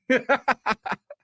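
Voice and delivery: laugh. Real, not acted. Laughing after his impression.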